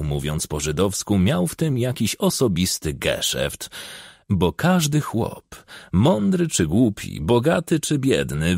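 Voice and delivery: usual speaking voice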